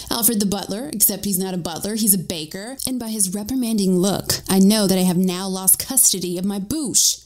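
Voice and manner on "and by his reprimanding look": plain reading voice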